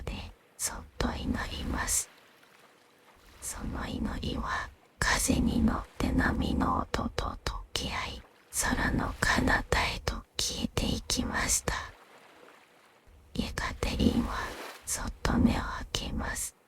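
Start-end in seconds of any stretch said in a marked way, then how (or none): none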